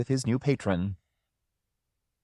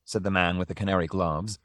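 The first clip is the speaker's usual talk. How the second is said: usual speaking voice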